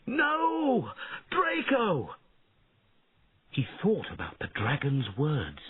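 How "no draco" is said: anguished cry. A narrator's reading. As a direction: cry out as the dragon falls. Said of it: "Bowen watched and shouted".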